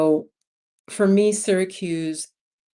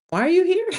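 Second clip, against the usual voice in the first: high, loud disbelieving question; incredulous local; 12 semitones higher, 6 dB louder than usual. Retold or imitated. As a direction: mimic an astonished local, pitched way up. Mimics amazed locals questioning her.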